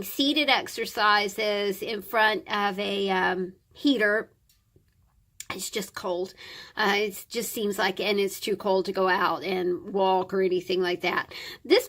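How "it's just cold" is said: whispering. Real, not acted. Aside about the cold.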